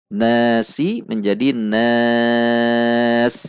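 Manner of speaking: nas drawn out very slowly; long final vowel. Shown as a demonstration. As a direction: stretch the a in nas. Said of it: "mad arid lis sukun".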